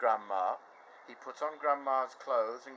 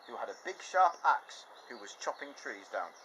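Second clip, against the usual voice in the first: usual speaking voice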